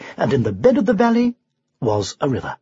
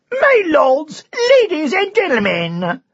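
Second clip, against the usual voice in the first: royal herald; 11 semitones higher than usual. A narrator's reading. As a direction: shout it to the whole field. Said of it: "shouted the herald".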